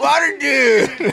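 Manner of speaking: high, slow triumphant shout; hero shout. Acted. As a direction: belt out the hero's name.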